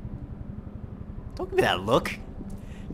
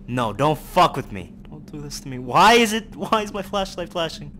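normal talking voice

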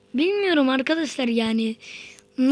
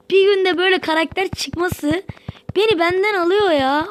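higher, louder delight; loud, high ecstatic gushing; overwhelmed; 5 semitones higher, 4 dB louder than usual. Real, not acted. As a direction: let the joy carry you away. Overwhelmed by the drop.

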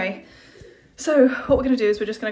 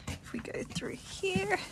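whisper; 10 semitones higher, 10 dB quieter, slower than usual. Real, not acted. Whisper, unvoiced, quiet.

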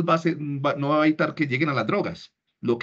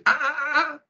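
high, sing-song warning ah ah ah; a scolding brake on a child, pitched up high; scolding a child who reaches for something, warning ah ah ah; 10 semitones higher than usual. Shown as a demonstration. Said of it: "esa palabra ah, ah, ah, es un freno".

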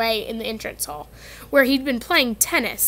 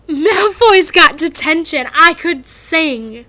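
louder, slow gleeful voice; 4 semitones higher, 8 dB louder, slower than usual. Acted. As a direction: gloat happily. Hermione dancing a jig.

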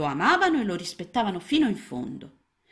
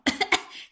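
little frog coughing and spitting; 4 dB louder than usual. A narrator's reading. Little frog choking on a dry fly, coughing. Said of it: "tossì e sputò".

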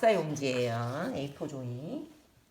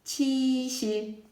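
very slow, loud 치실 with each syllable drawn out; slow model word; 7 semitones higher, 6 dB louder, much slower than usual. Shown as a demonstration. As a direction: stretch 치실 so kids can repeat it. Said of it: "다 같이 해볼까요?".

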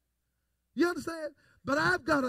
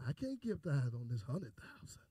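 mock stingy churchgoer; 14 semitones lower, 8 dB quieter than usual. Preacher mimics stingy churchgoer.

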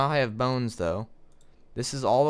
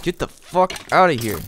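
angry yell; 5 semitones higher, 6 dB louder than usual. Real, not acted. Yelling at the mob.

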